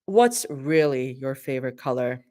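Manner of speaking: low, pressing, suspicious question; Mira voice. Acted.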